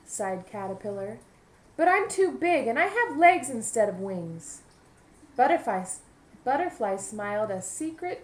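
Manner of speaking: lively picture-book narration; storybook voice for young listeners; reading to children. A narrator's reading. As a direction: read it aloud to a class of kids.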